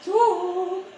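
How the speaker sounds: high squeaky laugh, voice cracking at the end; Neymar impression. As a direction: ham up a footballer's squeaky voice. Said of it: "my Neymar impression".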